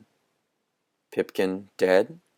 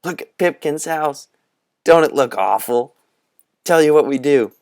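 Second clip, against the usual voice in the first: higher, louder rallying call; revving them up; 7 semitones higher, 6 dB louder than usual. A narrator's reading. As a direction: cheer the friends into action. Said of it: "Tom looked around and revved them up".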